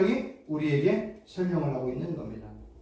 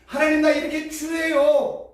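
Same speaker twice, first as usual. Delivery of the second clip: pleading prayer; 6 semitones higher than usual. Retold or imitated. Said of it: "하나님 앞에 보여주는 거야".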